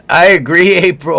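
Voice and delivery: sarcastic loud laughing agreement; loud mocking laugh. Real, not acted.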